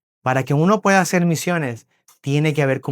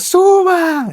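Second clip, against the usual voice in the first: high, loud chorus-of-kids imitation; children calling out; 14 semitones higher, 5 dB louder than usual. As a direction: call it out long and high like kids. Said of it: "Entonces todos los niños".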